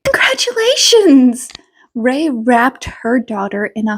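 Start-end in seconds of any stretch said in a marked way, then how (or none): 0.1-1.5 bright, high burst of joy; excited congratulations. Acted. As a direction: cheer her on with a high squeal. Mother congratulates daughter happily.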